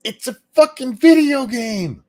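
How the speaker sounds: high, loud exasperated outburst; exasperated yell. Real, not acted. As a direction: shout it in disbelief.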